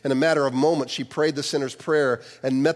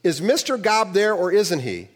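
higher, firm demand; firm operator; 6 semitones higher, 3 dB louder than usual. A narrator's reading. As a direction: press him firmly. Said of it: "said with some firmness".

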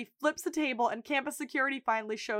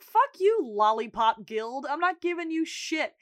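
high, loud mock defiance; defiant refusal; 4 semitones higher, 4 dB louder than usual. Store manager refusing young robbers, defiant refusal. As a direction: throw it back at them, pitched up.

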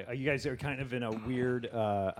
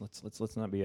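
usual speaking voice

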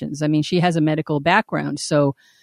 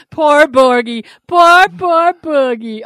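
high, slow, drawn-out pity; mock pity; 9 semitones higher, 5 dB louder, much slower than usual. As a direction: coo it like pitying a pet.

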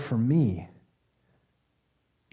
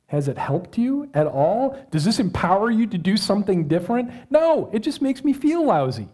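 insistent questions building to a high outburst; exasperated self-confrontation; 6 semitones higher, 4 dB louder than usual. Acted. Preacher confronting himself in the mirror, building exasperation. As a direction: challenge yourself, rising to an exasperated cry.

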